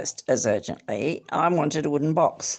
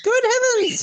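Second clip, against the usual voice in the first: very high, louder surprised exclaim; 4 dB louder than usual. Acted. Host realizing who the guest is, high loud exclaim. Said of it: "he exclaimed".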